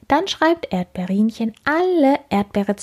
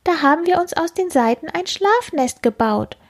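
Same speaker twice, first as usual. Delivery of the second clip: high sheepish bug voice; confessing beetle; 9 semitones higher than usual. Acted. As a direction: own up in a tiny voice. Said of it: "gesteht der dritte Käfer".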